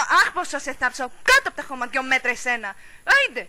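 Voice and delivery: loud quarrelling outburst; heated, shouted curse; angry threat. Acted. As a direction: hurl the threat at full volume.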